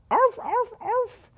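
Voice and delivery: high, tiny yapping barks; yappy chihuahua voice. Retold or imitated. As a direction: yip like a little lapdog. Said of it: "Not that kind of dog".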